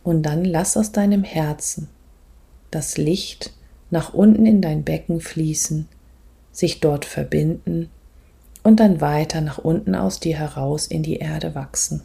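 soft, calm meditation voice, often whispered; guided meditation. Acted. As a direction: guide the listener gently inward.

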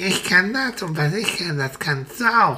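hohe, flinke Vogelstimme; fröhlicher Spatz, viel höher, munter; Spatz Frido. Acted. Frido spricht weiter. (Spatz Frido stellt sich den Kindern fröhlich vor)

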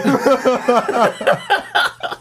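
high burst of laughter. Real, not acted. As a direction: crack up at the punchline. Story punchline. (lautes Auflachen)

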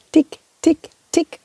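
crisp ticking like a clock; light mock clock ticking; ticking clock imitation. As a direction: tick it out evenly like a clock. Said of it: "fing wieder an zu ticken".

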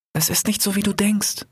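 put-on high girl's voice; young sister voice. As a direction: speak as a little sister. Young sister Raya in dream.

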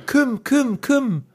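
high, far-off call of a name; calling from afar. Retold or imitated. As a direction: call out across a distance.

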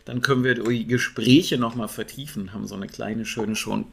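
usual speaking voice

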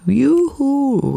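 a high little cheer of joy; excited cheer. Real, not acted. Host alone at the mic celebrating the premiere, high excited cheer. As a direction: squeal it out like a celebration. Announcing the radio play premiere.

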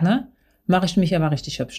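ordinary delivery

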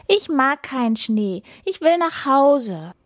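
high, whiny little deer; mild acted whine, wanting out; tearful whining. A narrator's reading. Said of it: "jammerte es und weinte". (leicht weinerlich gejammert)

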